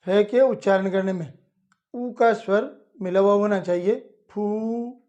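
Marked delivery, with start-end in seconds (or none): 4.3-5.0 फू with a long, full ऊ; long u sound. Shown as a demonstration. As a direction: stretch the ऊ in फू. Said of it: "इस तरह". (फू को लंबे ऊ के साथ)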